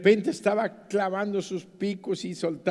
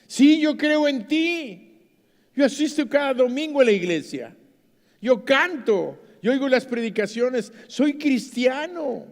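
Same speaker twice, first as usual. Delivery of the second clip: eager protest; 8 semitones higher, 5 dB louder than usual. Retold or imitated. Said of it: "Y le dice este hombre".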